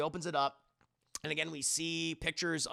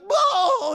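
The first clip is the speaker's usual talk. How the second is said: high, loud startled whoa; startled yelp; 14 dB louder than usual. Retold or imitated. Goofy startled by the boss's loud call. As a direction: jump out of your skin. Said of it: "And he's like".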